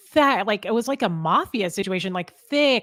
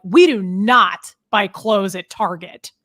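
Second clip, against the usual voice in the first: louder and slow, a mock stern dad; retold angry dad; 8 dB louder, slower than usual. Dad scolding his daughter about shopping, mock angry. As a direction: lay down the law like an irritated father. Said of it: "it was like he was mad about it".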